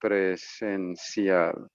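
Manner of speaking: Presencial drawn out very slowly; slow and careful. Shown as a demonstration. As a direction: stretch Presencial out for the learner. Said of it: "In person would be presencial".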